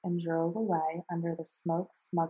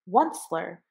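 louder, croaking call of the name; querulous croak; 8 dB louder than usual. A narrator's reading. Lorax scolding the Onceler, louder querulous croak. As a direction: cry it out like a querulous old creature. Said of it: "he cried with a querulous croak".

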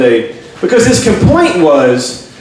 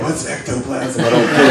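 clueless audience member; 8 semitones lower, 7 dB quieter than usual. Acted. Clueless people to comic, low soft puzzled voice. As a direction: drop way down and soft, baffled. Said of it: "fucking people are like".

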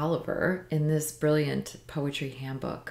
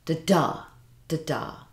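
"Da-da, da-da" as weak-strong pairs, second "da" stressed; heartbeat rhythm; 3 dB louder than usual. Shown as a demonstration. Teacher sounding out iambic heartbeat, stressed second beat. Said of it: "it's the standard beat of a heart".

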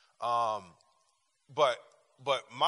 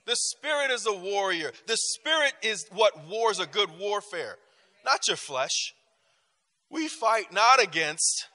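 raised, slow preacher's proclamation; preaching; 7 semitones higher, 6 dB louder than usual. Acted. Pastor preaching to the congregation, raised and slow. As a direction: proclaim it from the pulpit.

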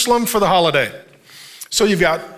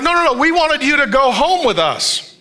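parents insisting; 5 semitones higher than usual. Retold or imitated. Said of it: "They're like".

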